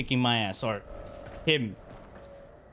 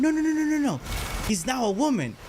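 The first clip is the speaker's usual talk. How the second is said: mom correcting; 9 semitones higher, faster than usual. Retold or imitated. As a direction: squeal it out like an exasperated mom.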